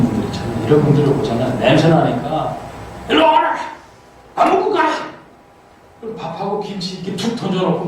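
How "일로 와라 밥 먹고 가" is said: grandma calling out. Retold or imitated. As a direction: shout them in like an old granny. (할머니가 나그네를 부르듯 높고 큰 외침)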